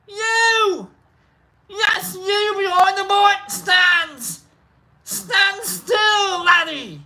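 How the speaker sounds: high, hollering Scottish schoolmaster; Scottish accent. Acted. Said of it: "This is where I get my Scot".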